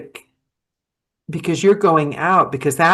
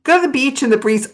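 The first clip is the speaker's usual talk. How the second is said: high, airy imitation of a friend's advice; mock breezy advice; 8 semitones higher than usual. Mimicking a friend's carefree beach advice, high and quick. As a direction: toss it off lightly, pitched high and quick. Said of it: "And she said".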